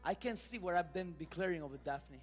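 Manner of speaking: low, soft prophetic declaration; subdued, softly spoken sermon; hushed preaching. Real, not acted. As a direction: drop low and quiet to the congregation. Quiet declaration over children.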